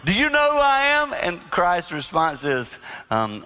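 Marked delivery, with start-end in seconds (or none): 0.0-1.1 loud, high, imperious challenge; arrogant emperor demanding. Retold or imitated. Roman emperor confronting Jesus, loud and high. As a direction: bark it like a proud ruler testing a prisoner. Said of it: "the Roman emperor".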